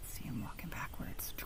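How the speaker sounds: whisper. A narrator's reading. Narrator's whispered aside.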